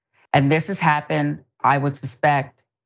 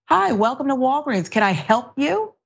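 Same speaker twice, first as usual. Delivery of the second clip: higher, cheerful welcoming shop clerk; friendly clerk greeting; 8 semitones higher than usual. Retold or imitated. Host acts out how the clerk should greet the mayor, pitched up and friendly. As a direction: greet the customer sweetly, like a model employee. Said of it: "you just say".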